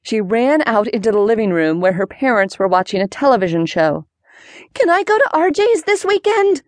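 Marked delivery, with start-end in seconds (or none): 4.7-6.6 very high-pitched, hopeful plea. A narrator's reading. Daughter asking her parents for a weekend visit, hopefully. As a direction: ask permission in a high, eager voice. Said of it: "she asked hopefully".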